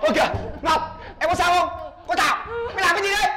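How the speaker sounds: loud, high and fast alarmed reprimand; alarmed shout. Acted. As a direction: rush in and yell at her. Rushing in, scolding.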